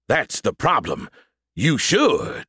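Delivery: slow fake Southern pastor; hammed-up Southern preacher, stretching the last words; awful Southern accent. A narrator's reading. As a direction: drawl it suggestively in a Southern accent. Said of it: "putting on my awful Southern accent".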